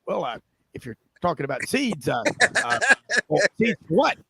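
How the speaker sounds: lightly imitated dithering sitcom character; Mister Kimball. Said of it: "He go".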